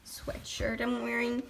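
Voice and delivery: ordinary delivery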